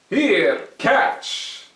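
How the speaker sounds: loud call to catch; Herschel, calling. Acted. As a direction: toss it over. Herschel tossing.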